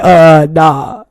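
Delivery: lightly voiced fiance shutting it down; fiance, jealous. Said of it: "My fiance would be like".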